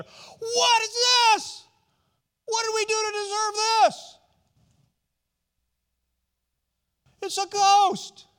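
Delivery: hammed-up high, loud wail of panic; terrified crying out. Disciples nudging each other in the boat, mock-terrified crying out. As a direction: act out panicked disciples, shrieking and drawn out. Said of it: "they're all crying out together".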